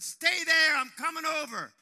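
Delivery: loud high call across the woods. Retold or imitated. Running to a lost boy, shouting reassurance loudly. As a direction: shout it so the lost boy can hear you.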